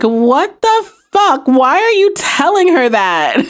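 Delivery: high, slow, drawn-out mock outrage; retold exasperated disbelief. Recapper voicing an inmate appalled at her friend, mock exasperated disbelief. Piper's retold reaction to Lolly.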